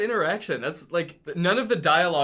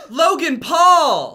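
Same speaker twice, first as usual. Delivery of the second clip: shocked shout; 11 semitones higher, 10 dB louder, much slower than usual. Acted. Acted surprised line.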